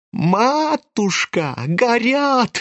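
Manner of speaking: high, drawn-out wail. A narrator's reading. Lebedev wailing over the burning money, high and stretched. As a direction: wail it long and high in anguish. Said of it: "вопил Лебедев".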